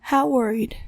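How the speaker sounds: plain reading voice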